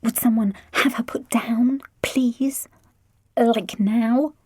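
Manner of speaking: put-on whispered teen grumble; muttered. A narrator's reading. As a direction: mutter it under your breath. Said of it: "Lydia muttered".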